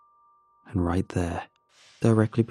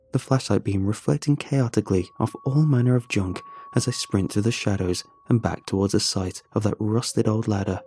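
ordinary delivery

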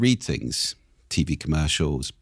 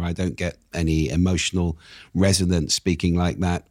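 usual speaking voice

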